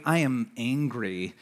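mock bottled-up fury; inner seething. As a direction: say it through a clenched jaw. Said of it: "but internally".

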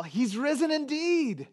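"He's risen indeed" sung out high, like calling from the front door; demo yell. Shown as a demonstration. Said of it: "and yell".

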